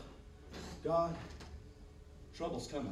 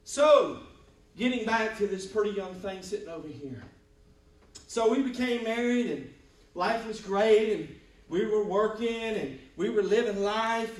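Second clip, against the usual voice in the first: loud, high, building preacher's cry; excited shout; 6 semitones higher, 7 dB louder than usual. Real, not acted. Pastor telling church about married life, loud and excited. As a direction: lift the congregation with a loud joyful call.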